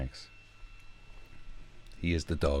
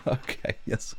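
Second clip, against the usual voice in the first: awkward laughing, higher-pitched politeness; polite humouring; 7 semitones higher, slower than usual. Acted. Humouring an intense stranger, mock polite laughing. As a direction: play polite through nervous laughter. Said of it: "do the polite thing of just going".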